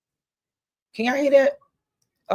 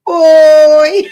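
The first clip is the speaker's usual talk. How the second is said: loud outcry; 8 semitones higher, 15 dB louder than usual. Real, not acted. Host reacting to the movie clip with a loud outcry. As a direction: let it burst out high and loud.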